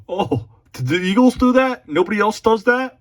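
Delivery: high, loud sarcastic disbelief; mock surprise. Real, not acted. Mocking the chatbot's answer, sarcastically high and loud.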